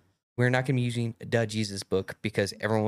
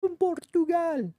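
playful shout; 3 dB louder, much slower than usual. Pretend. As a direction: shout the name much higher and louder. Repeating the gaming catchphrase.

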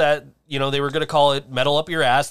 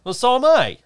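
high, loud and indignant; indignant outburst; 9 semitones higher, 6 dB louder than usual. Real, not acted. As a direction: snap back, offended. Bickering over the drink.

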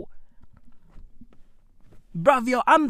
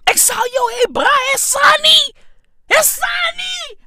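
imitated shouting prisoners; 11 semitones higher than usual. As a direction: yell the names like an inmate across the yard. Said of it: "motherfuckers are out there going".

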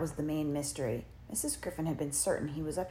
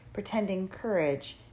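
plain reading voice

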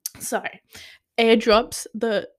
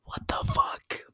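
soft, voiceless disbelief; stunned whisper; 9 dB quieter than usual. Real, not acted. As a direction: whisper it, dumbfounded. Stunned by the episode's ending.

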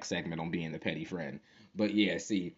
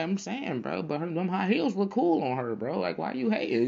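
1 high teasing; 9 semitones higher than usual. Retold or imitated. Teasing friend, voice much higher.

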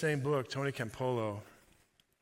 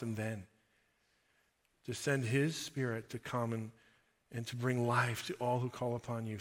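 soft, earnest prayer voice; 5 semitones lower, 6 dB quieter than usual. Acted. Preacher leads the listeners in prayer, soft earnest prayer. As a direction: pray with the congregation, quietly.

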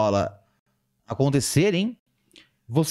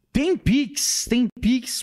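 excited hype; 10 semitones higher, much slower than usual. Real, not acted. Hyping paid messages on stream.

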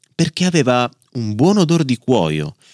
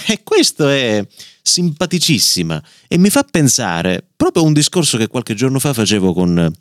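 plain reading voice

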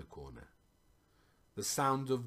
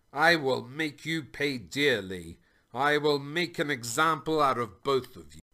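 loud, raised royal threat; yelling threat; 4 semitones higher, 7 dB louder than usual. A narrator's reading. King threatens prisoners sent to the dungeons, yelling. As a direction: yell it after them as they're taken away. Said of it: "yelling after them".

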